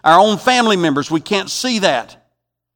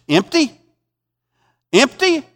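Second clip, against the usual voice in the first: high, rising disbelief; mock incredulity; 10 semitones higher, 4 dB louder than usual. Preacher challenging Naomi's claim of emptiness, incredulous. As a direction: echo the word as an astonished question.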